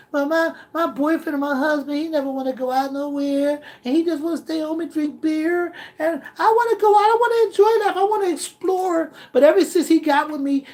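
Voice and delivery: high, soft put-on woman's voice. Girlfriend complaining to the producer about her man. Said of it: "How you feeling right now?".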